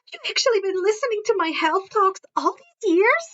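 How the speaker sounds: lightly voiced Hermione teasing. Hermione teasing Harry at breakfast, playful.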